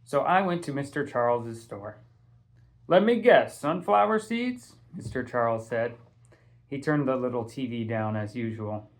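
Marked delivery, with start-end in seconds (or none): 2.9-4.8 put-on higher, louder, knowing old-man tease; teasing shopkeeper. A narrator's reading. Shopkeeper Mister Charles guessing Castle's usual order, playful higher tease. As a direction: rib the regular kid like you know his order.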